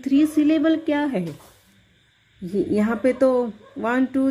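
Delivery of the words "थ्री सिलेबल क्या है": higher, quick voice for a pupil's question; student asking teacher. Pretend. Teacher voicing a student who asks about syllables, as a pupil's question. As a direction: lightly voice a puzzled student's query. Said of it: "आप पूछते तो".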